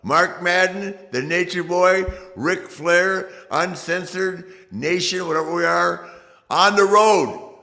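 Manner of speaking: loud, high hype promo; wrestling promo. Real, not acted. Hyping the road tour promo.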